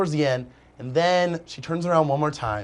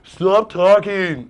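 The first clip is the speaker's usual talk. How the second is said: slow mock yell; 5 semitones higher, 7 dB louder, much slower than usual.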